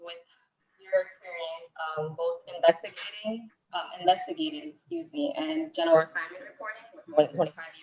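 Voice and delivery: faint bad-connection voice; far from mic. Real, not acted. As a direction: voice cutting out, distant. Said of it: "you're going in and out".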